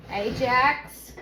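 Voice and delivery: calling the cat. Real, not acted.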